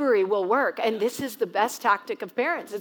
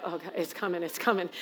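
hushed dread, much softer; dread under breath; 5 semitones lower, 9 dB quieter than usual. Retold or imitated. Mom sensing her daughter's meltdown, hushed dread. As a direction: mutter it low, bracing for the storm.

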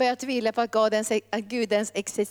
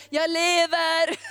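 high, drawn-out cry of declaration; exultant proclamation; 5 semitones higher, 3 dB louder, slower than usual. Acted. God declares the foundation of faith, exultant proclamation. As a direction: proclaim it slowly, ringing out. God's proclamation voiced by preacher.

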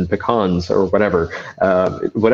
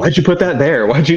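high, louder mock scolding; 8 semitones higher, 4 dB louder than usual. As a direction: scold yourself, hammed up.

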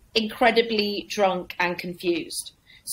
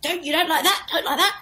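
pitched-up pleading comic voice; desperate comic; 7 semitones higher than usual. Retold or imitated. Comic losing the crowd asks if they like this, pleading. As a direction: play the failing comic, higher and laughing. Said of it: "so he said".

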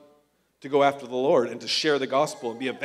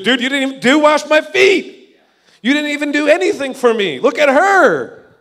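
mock rebuke; 9 semitones higher, 8 dB louder than usual. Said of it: "He's like".

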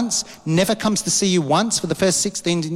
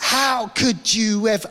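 indignant outburst; 6 semitones higher, slower than usual. Retold or imitated. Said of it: "And I was like".

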